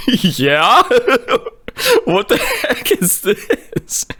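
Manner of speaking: high, laughing disbelief; nervous laughing reply. Retold or imitated. Teenager answering a creepy stranger at the fire, laughing uneasily. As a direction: say it through incredulous giggles.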